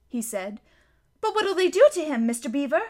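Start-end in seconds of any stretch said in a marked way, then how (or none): 1.2-2.8 high, fast, frightened gasp; Lucy catching her breath in alarm; gasped question. Acted. Lucy begs Mister Beaver about Tumnus, gasping fearfully. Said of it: "gasped Lucy".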